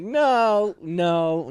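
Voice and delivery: high, loud opening denial, easing off; mock protest. Trainee denying his brother shot better, mock indignant protest. Acted protest to the XO's teasing.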